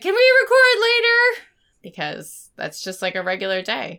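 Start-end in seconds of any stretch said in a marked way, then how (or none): 0.0-1.4 pleading. Retold or imitated. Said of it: "I was like".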